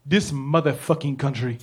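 put-on low, soft African-accented grumble; disgusted cab driver with an African accent. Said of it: "say a big word in a funny accent".

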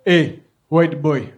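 put-on slow African-accented cab driver; slowly, as an old African cabbie with an accent. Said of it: "say a big word in a funny accent".

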